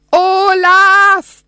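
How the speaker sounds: high, long-held yell of the name; loud, drawn-out calling yell; bellowed shout. A narrator's reading. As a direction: shout it out as if to someone three storeys below. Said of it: "brüllte ich mehrmals".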